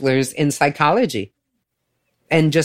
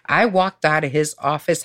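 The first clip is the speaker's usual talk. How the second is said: normal talking voice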